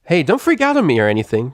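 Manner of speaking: high, loud exclamation; exclaiming. A narrator's reading. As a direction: blurt it out quickly. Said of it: "Rainbow Dash exclaimed".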